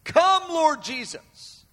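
high, drawn-out cry to Jesus. Acted. Preacher voices the bride's cry to Jesus, crying out slowly. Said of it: "they're crying out".

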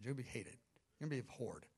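Father quietly warning the Son of hatred; hushed grave warning. Acted. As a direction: let the warning sink low.

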